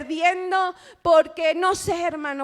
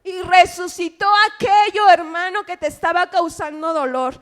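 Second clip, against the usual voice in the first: preaching; 2 semitones higher, 6 dB louder than usual. Acted. Predica a gritos.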